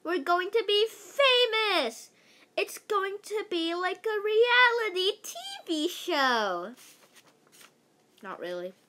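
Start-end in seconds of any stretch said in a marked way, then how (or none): 0.0-6.7 put-on much higher, much louder girlish scream; screaming with excitement. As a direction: shriek it like thrilled schoolgirls. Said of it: "screaming and freaking out".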